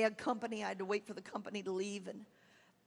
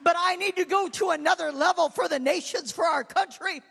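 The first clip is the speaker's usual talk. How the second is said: near-shouting, high and much louder, pleading to her pastor; impassioned tearful plea; 7 semitones higher, 12 dB louder than usual. Retold or imitated. Pleading with her pastor to accept the car, tearful near-shout. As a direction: pour it out at full force, close to tears. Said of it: "Finally, I'm weeping".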